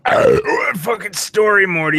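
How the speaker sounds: high, slow, drawn-out Rick voice; hammy exasperated Rick; Rick impersonation. Pretend. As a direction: address your sidekick as a cartoon Rick. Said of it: "he was doing the impersonation".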